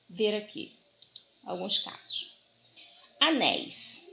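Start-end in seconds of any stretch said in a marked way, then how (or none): 3.2-4.1 "Anéis" with NÉIS stressed, falling. Shown as a demonstration. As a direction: stress the last syllable of "Anéis". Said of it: "Vamos pensar na palavra anéis". (ênfase na sílaba NÉIS de "Anéis")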